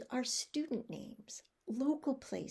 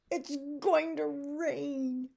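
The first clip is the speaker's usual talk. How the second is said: slow, higher-pitched angry complaint; 4 semitones higher, 4 dB louder, slower than usual. Shown as a demonstration. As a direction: grumble angrily about ruined wedding plans. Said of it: "Say it angrily".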